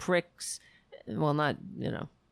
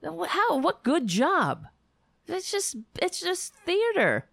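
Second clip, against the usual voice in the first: much higher, exasperated; incredulous outrage; 12 semitones higher than usual. Real, not acted. Ranting about the Putin interview, high exasperated voice. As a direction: scoff at it in disbelief.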